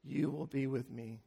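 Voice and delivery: softly, fading away to almost nothing; hushed and gentle, as if heard in prayer; tender whisper-soft promise. Retold or imitated. The Spirit's quiet promise to the preacher, fading softly.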